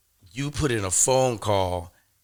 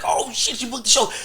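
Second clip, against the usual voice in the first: excited disbelief; 12 semitones higher than usual. Retold or imitated. Friend just booked the show.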